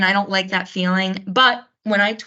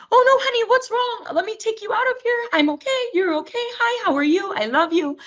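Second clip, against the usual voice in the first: very high-pitched cooing mom voice; hammed-up mom doting on her crying child; fussing mom. Mom rushing in to her crying kid, exaggerated high fussing. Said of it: "the kid's crying and mom goes in".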